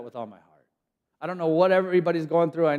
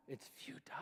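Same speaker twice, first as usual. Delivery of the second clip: unvoiced secret whisper; whispered futile, nearly silent; 26 dB quieter than usual. Shown as a demonstration. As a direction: lean in and breathe it.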